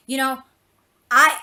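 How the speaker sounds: normal talking voice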